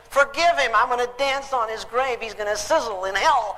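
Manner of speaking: hammed-up vengeful outburst; outraged refusal. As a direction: spit it back in mock disbelief. Acted outrage of bitter woman.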